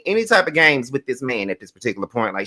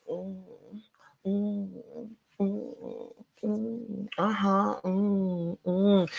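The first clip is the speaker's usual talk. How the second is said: soft, higher-pitched mock moans; imitated moaning; 6 semitones higher, 9 dB quieter, much slower than usual. Recapper imitating two people hugging all over each other, mock moaning. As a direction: moan softly, imitating a clingy hugging couple. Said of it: "moaning and going".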